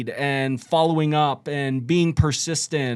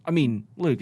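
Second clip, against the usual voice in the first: normal talking voice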